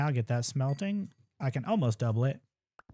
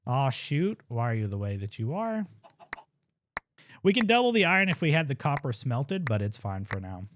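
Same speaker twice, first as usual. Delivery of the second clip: ordinary delivery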